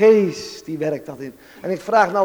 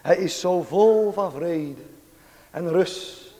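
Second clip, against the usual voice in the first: tender devotional preaching; 4 semitones lower than usual. Real, not acted. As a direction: savour each phrase in hushed reverence. Devotional close in adoration.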